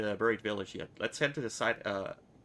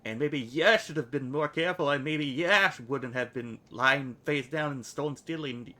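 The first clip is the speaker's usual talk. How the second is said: put-on louder, higher, a brash girl snapping back; loud retort; 4 semitones higher, 4 dB louder than usual. Anna snapping back at the nameless one, louder. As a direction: hit back hard, faster at the end.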